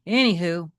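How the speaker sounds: ordinary delivery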